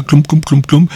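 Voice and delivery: rhythmic cloum, cloum like wooden soles on the ground; clog footsteps. Shown as a demonstration. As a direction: knock out the sound of walking in clogs. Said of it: "où ça fait un peu ça".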